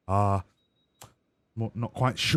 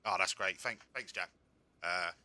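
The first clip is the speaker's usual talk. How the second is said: ordinary delivery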